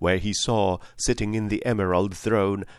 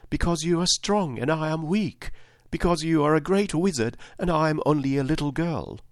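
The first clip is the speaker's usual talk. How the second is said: put-on high child's voice, pleading; small girl appealing to someone powerful; pleading little girl; 7 semitones higher than usual. Weak little girl appeals to great wizard, high and pleading. Story figure, Dorothy pleads.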